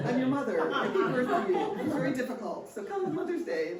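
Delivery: high, loud, laughing teasing; laughing mock guilt trip. Mother guilting her kids into coming, laughing. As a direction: lay on the guilt as a joke.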